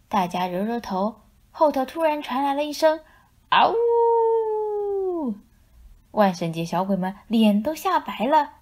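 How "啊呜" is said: very high long howl; a sudden howl from behind; wolf howl. A narrator's reading.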